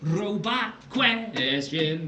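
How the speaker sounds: slow flat robot voice. Pretend. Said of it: "Robot word question?".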